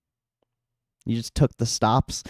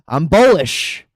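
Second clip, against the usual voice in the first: usual speaking voice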